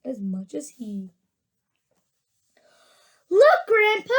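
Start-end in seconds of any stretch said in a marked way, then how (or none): 3.3-4.1 high, loud call to grandpa; excited cry. A narrator's reading. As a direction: call out in wonder. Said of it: "cried Charlie".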